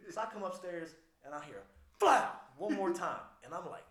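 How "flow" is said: gunshot imitation.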